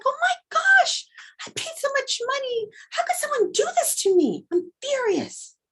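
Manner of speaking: furious outburst. Pretend. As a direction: cry out over a vandalized room. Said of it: "And you're like".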